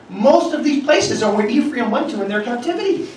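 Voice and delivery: ordinary talk, nothing marked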